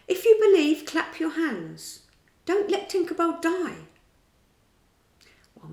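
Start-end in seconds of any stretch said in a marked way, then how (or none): 0.1-3.9 high and loud, begging; pleading call. A narrator's reading. Peter begs the children to clap for Tinker Bell, loudly. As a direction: plead across the night.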